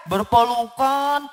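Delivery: ordinary delivery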